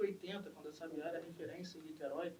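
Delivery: usual speaking voice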